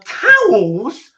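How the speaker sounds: put-on high, loud, baffled kid; child's disappointed voice. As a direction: sound like a let-down little kid. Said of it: "So as a kid".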